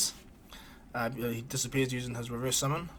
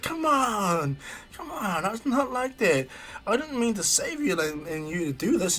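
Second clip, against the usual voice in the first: flustered protest; 7 semitones higher, slower than usual. Acted. Naruto protesting to laughing friends, high flustered whine. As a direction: protest in a pitched-up panic.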